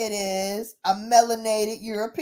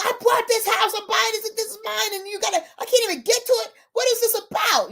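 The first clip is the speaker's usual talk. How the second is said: much higher, indignant mock rant; pissed off; 10 semitones higher than usual. Buyer protests he cannot reach his house, imitated angry rant. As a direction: imitate an outraged owner protesting. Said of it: "he's pissed".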